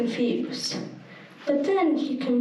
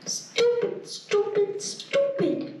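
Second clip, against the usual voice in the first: high, frustrated self-reproach; self-scolding; 7 semitones higher, slower than usual. A narrator's reading. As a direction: kick yourself, higher each time. Brian scolds himself.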